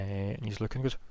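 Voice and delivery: ordinary talk, nothing marked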